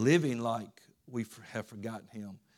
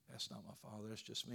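very soft, deflated voice; retold letdown; 2 semitones lower, 13 dB quieter, faster than usual. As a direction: drop right down, imitating a let-down Simba. Simba disappointed by his own reflection.

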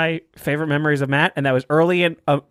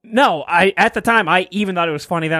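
ordinary talk

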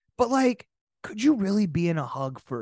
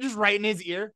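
normal talking voice

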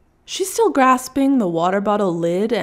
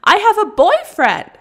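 put-on builds from firm to much higher and louder, peaking on boyfriend; rising fury; 5 semitones higher, 5 dB louder than usual. Russian woman declaring she has a boyfriend. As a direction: escalate until you declare it. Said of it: "she declares".

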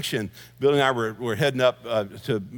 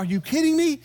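a much higher, disbelieving squeak; incredulous; 13 semitones higher than usual. Retold or imitated. Pastor to the pilots who smiled through a storm, incredulous. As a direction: pitch it up in mock disbelief. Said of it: "I'm going".

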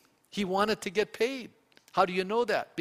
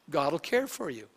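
usual speaking voice

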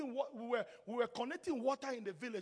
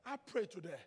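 ordinary talk

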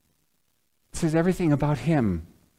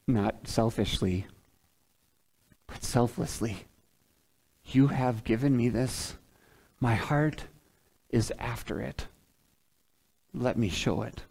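hushed prayerful preaching; 2 semitones lower, 5 dB quieter than usual. Real, not acted. Preacher praying to show selfless love, hushed. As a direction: drop to a quiet, prayerful tone with pauses.

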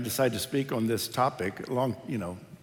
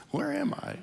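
high, soft bewildered question; lost elderly mother; 12 semitones higher, 6 dB quieter than usual. Retold or imitated. Pastor voicing his mother starting over again. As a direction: ask it softly like a lost old mom.

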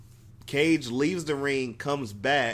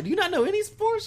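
incredulous quote; faster than usual. Retold or imitated. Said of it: "I'm like".

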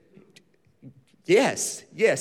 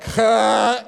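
a loud, shouted imitation of a huh; loud mock huh; 2 semitones higher, 6 dB louder than usual. Pastor mimicking one man's loud huh in the crowd, shouted. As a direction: blurt out huh loudly in a mock voice. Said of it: "This one guy over here is like".

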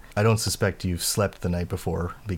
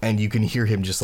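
ordinary talk

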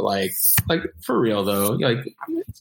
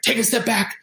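mock bossy warning; 11 semitones higher than usual. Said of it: "and he was like".

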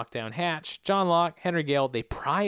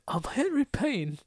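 slow, higher and soft with rasp; soft raspy voice; 8 semitones higher, 4 dB quieter than usual. Retold or imitated. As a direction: drag the name out softly. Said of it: "he's like".

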